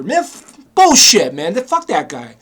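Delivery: real anger, bursting out with bullshit; angry outburst. Real, not acted. To the listener about the scamming mechanic, angry outburst. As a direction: spit out the curse in frustration. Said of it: "I was so angry".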